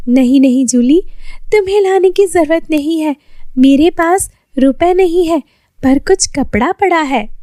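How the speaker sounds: put-on Lily explaining she can't afford cloth, girlish voice; young girl voice. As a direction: voice the poor tailor girl, gently pleading. Lily refuses the offer.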